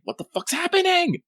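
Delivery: party running toward the blast in panic; high panicked cry. Retold or imitated. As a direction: voice shoots high in alarm. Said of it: "like, like".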